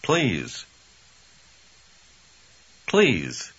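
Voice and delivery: normal talking voice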